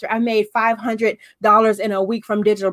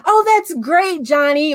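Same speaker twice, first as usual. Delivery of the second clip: forced mom praise; 6 semitones higher, slower than usual. Retold or imitated. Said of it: "you're sitting there like".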